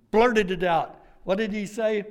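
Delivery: ordinary talk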